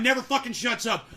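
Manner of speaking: ordinary talk, nothing marked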